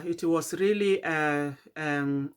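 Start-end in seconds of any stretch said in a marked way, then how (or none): 1.0-2.3 words stretched by a yawn. Real, not acted. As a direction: talk through a sleepy yawn. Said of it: "I'm yawning".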